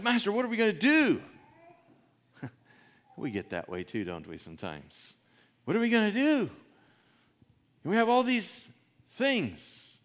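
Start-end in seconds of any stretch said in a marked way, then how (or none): 0.0-1.2 panicked high rapid plea; a servant frantic with worry, rushing the words; high, loud, fast anxious cry; worked up. Retold or imitated. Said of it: "The servant was worked up". 5.6-10.0 high, loud fretful wail; mock panic, high and loud; anxious. Voicing the anxious servant again.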